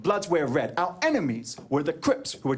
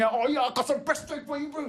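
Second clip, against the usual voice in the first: high mock drunk comeback; hammed-up drunken threat; drunk bro; 8 semitones higher than usual. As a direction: imitate a boozy brawler taunting back. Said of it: "fight like drunk bros".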